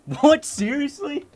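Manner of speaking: very high, startled outburst; astonished disbelief. Real, not acted. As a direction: burst out high and incredulous. Startled reaction while reading chat.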